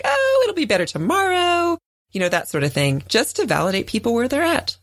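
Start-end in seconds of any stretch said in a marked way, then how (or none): 0.0-1.8 chirpy silver lining. Retold or imitated. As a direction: cheerfully wave the problem away. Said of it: "be like".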